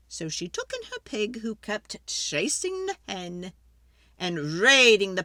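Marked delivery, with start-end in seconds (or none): none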